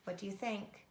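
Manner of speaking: ordinary delivery, nothing marked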